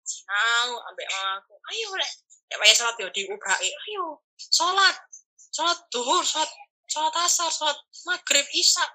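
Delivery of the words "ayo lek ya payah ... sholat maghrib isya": ibu mengomel, nada naik; omelan ibu. Retold or imitated. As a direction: omeli anak yang mau ujian. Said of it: "Diomeli aku". (ibu mengomel karena anak malas sholat)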